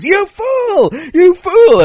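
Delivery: high shrieked "You fool!" twice; mock screaming. Host screams at the contestant for agreeing, high shriek. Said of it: "And he goes".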